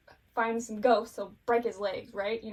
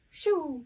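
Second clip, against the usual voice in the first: high, loud "shoo" sword whoosh; 5 semitones higher, 7 dB louder than usual. Retold or imitated. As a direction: imitate a glowing arc slicing past. Imitates a sword arc whooshing.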